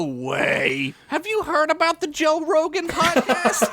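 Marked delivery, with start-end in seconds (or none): 1.1-3.6 high-pitched, breaking into laughter; barely straight-faced. Acted. Joking after "favorite podcast ever", high and barely holding back laughter. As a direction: tease in a high voice, on the edge of cracking up. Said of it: "I could barely say that with a straight face".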